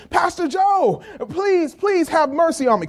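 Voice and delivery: high, pleading cry for mercy; begging plea. Acted. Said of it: "he begs and pleads and says".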